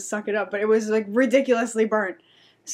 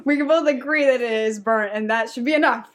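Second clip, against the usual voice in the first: giggling through a louder, higher protest; laughing indignation; 4 semitones higher, 3 dB louder than usual. Real, not acted. Telling a friend about the burnt pizza, laughing and indignant. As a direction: laugh while insisting on the point.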